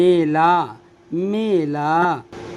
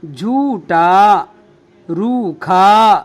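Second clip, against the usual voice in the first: slow, drawn out; 4 dB louder, much slower than usual. Shown as a demonstration. Teacher reading words aloud to students, slowly drawn out. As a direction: read each word very slowly, vowels held. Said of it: "क्या है?".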